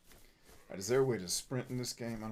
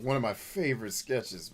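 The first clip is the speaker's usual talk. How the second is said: usual speaking voice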